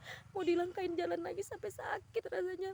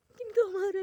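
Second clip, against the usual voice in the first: anguished outburst; 5 semitones higher, 9 dB louder than usual. Retold or imitated. Praying to God, crying out the plea louder. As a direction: cry out louder and higher in despair.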